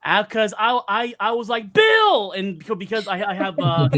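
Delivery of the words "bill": shouted name, high and loud; loud call. Retold or imitated. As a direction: call across the hall to get his attention. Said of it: "loud projecting voice".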